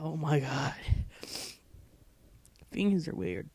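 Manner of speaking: normal talking voice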